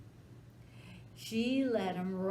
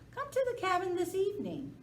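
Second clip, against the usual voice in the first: eager invitation; 12 semitones higher, 5 dB louder, faster than usual. Acted. Dog eagerly inviting alligator.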